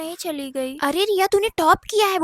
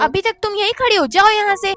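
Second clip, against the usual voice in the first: higher, louder angry dismissal; angry shout; 4 semitones higher, 6 dB louder than usual. Acted. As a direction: throw her out with a shout. Stepmother drives maid away. (गुस्से में चिल्लाकर भगाना)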